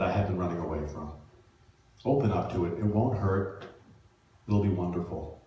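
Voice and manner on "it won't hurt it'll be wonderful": ordinary talk, nothing marked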